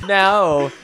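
high, loud laugh breaking into a no; bursting laugh. Real, not acted. Guest laughing at the casual murder question. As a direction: laugh out the word no.

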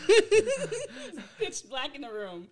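laughing through the words; giggling, slow and loud. Real, not acted. Laughing while retelling.

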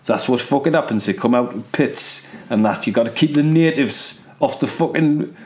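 mock Geordie pit-town local; Geordie accent. Joking about pit workers and their ale, in a Geordie accent. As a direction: put on broad Geordie vowels. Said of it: "I fucking love the Geordie accent".

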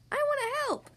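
high-pitched, eager piping; eager cry. A narrator's reading. Young frog in grandma's kitchen, eager high cry. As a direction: pipe up high, keen to join in. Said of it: "cried Froggy".

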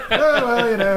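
loud, high mock consolation; exaggerated British-comic impression; Steve Coogan. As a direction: mimic the awkward remark, hammed up. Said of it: "sounded just like Steve Coogan".

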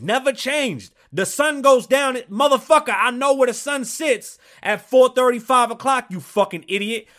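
high, loud, furious shouting; furious rant. Real, not acted. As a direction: yell it like you've totally lost it. Furious rant about the sun.

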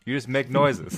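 normal talking voice

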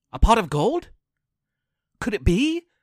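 ordinary delivery, nothing marked

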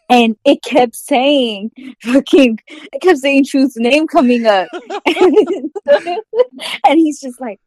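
usual speaking voice

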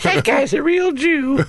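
very high, hammed-up granny voice; mock Grammy Hall. Granny sizing up a guest, high-pitched mock granny voice.

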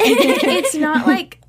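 laughing slow protest. Real, not acted. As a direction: laugh through the words.

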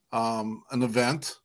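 ordinary talk

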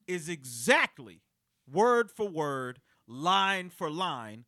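slow, deliberate word by word; slow emphasis. Real, not acted. Host spelling it out slowly to co-hosts, slow and deliberate. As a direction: drag it out for effect.

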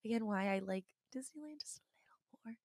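soft aside whisper. Real, not acted. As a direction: whisper it like a little secret. Playful confession aside.

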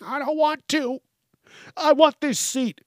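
imitating a stubborn woman who won't move; whiny woman. Woman refusing to give up seat.

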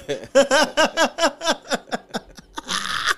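long high cackle of a laugh; real amused laughter. Real, not acted. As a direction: let a big giggle run on. Laughs at his own joke.

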